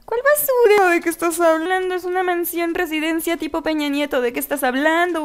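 high-pitched offended protest; mock indignation. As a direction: protest as if your mansion were insulted. Mock outrage at house called dump.

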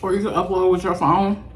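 talking between bites; chewing while speaking; mouth full of food. Real, not acted. Seen 0: Chewed burger just before; speaks with mouth partly full, burger held.

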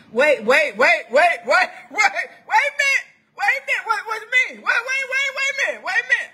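high, insistent stammer of disbelief; acted alarmed protest. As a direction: balk as if called up without warning. Acts out balking at God's call.

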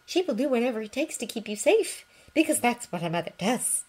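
high, soft reassuring mum; mother comforting a crying boy. Acted. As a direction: hold him close with your voice. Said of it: "she said softly".

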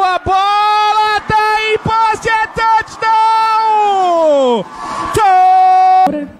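rising, building to a loud shout; touchdown call. Real, not acted. Touchdown scored, commentator erupts.

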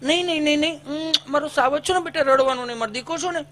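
consoling mother. Retold or imitated. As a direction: hush the child gently, mock sweet. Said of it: "માં છોકરાઓને સાની રાખતી".

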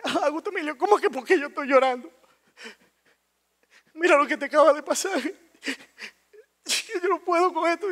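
high-pitched, wailing mom voice; imitating crying mom. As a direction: wail at your son through mock tears. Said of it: "yo encuentro a mami llorando". (imitación de mamá llorando, voz aguda)

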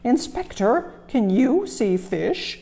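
high, slow amazed question; disbelieving, drawn out; the fat controller gasping at fish in a tank; astonished. Acted. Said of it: "nearly fell in surprise".

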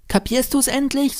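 put-on high-pitched impatient little boy; child Lennart. A narrator's reading. Lennart to his stepfather, impatient high child voice.

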